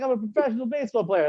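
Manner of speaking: high, offended retort; indignant protest. Retold or imitated. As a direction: defend yourself, stung. Indignant reply to mom.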